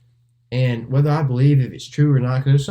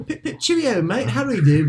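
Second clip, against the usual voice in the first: high, hammed-up posh British; British accent; 11 semitones higher than usual. Showing his British accent slipping, mock British voice. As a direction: squeak it out like a stage Englishman. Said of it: "a very typical British".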